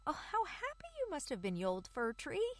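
very high, quick mouse squeal; excited mouse voice. Acted. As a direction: gush like a delighted little mouse. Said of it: "the mice said".

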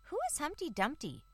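high eager mouse question; curious mouse voice. Acted. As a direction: ask like a curious little mouse. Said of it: "said the little mice".

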